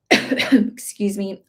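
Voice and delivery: real cough, then excuse me. Real, not acted. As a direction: cough into the mic, apologise. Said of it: "I hate coughing on camera".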